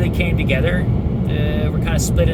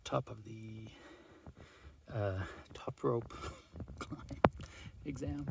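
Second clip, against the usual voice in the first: whispering; 5 semitones lower, 24 dB quieter than usual. Real, not acted. Hushed aside during exam.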